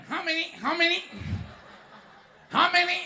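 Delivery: mock a rushed Chinatown waiter; brusque waiter. Chinatown waiter barking at customers, brusque.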